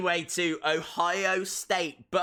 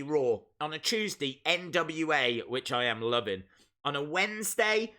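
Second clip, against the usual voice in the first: ordinary delivery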